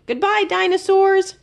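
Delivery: high, bright sing-song farewell; little hamster calling to the exhibits; calling goodbye. A narrator's reading. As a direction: call out a cheerful goodbye while running. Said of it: "Fergus called".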